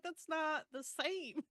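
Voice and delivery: high, drawn-out laughing objection; amused protest. Real, not acted. Host objecting to a heroine's excuse, amused protest.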